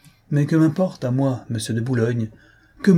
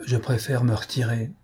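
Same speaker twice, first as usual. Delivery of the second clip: plain reading voice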